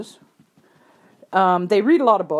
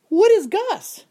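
high-pitched, puzzled retold question; curious; 13 semitones higher, 4 dB louder, slower than usual. Trainer asks rider about mystery pony, high and curious. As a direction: pitch it way up, wanting to know. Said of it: "kinda got a bit curious here".

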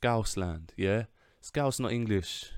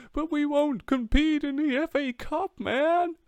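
squeaky, much higher mock whine of a moaning fan; mocking whiny fan. Mocking his own club's fans in a high, whiny falsetto. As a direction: sneer it in a silly falsetto. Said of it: "our own fans are going".

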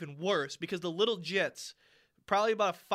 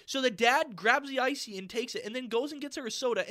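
ordinary talk

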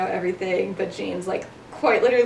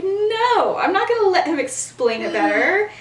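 high, loud flat no, then hot insistence; angry refusal; 9 semitones higher, 5 dB louder than usual. Retold or imitated. Remus refusing to let Sirius explain, retold angry outburst. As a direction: slam the door on James' suggestion.

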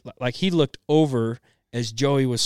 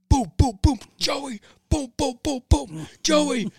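mock door pounding; 13 semitones higher, 5 dB louder, slower than usual. Dad pounding door, yelling.

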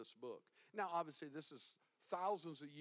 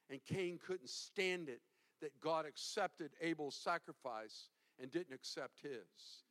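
ordinary talk

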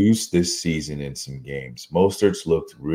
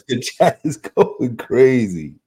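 slow, laughing and loud; 7 semitones higher, 4 dB louder, slower than usual. Real, not acted. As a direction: laugh it out slowly. Laughing at chat reaction.

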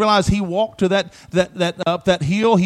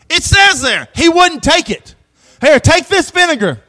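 fiery preaching; 4 semitones higher, 5 dB louder than usual. Acted. Preaching at full force.

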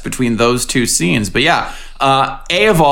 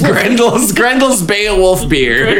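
slow amused; 6 semitones higher, much slower than usual. Real, not acted. Savoring the silly name, laughing.

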